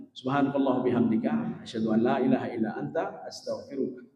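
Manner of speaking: low, soft recited closing du'a; closing prayer. Acted. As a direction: murmur the closing prayer and salam. (doa penutup yang lirih dan rendah)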